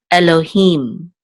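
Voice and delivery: "Elohim" slowly blended from its syllables; pronunciation demo. Shown as a demonstration. Teacher putting the Hebrew word together, slow demo. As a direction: draw out "Elohim", ending on a long "him". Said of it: "so this will be him".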